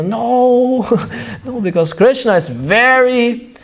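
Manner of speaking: lightly voiced high pleading queens; queens complaining. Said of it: "and they say".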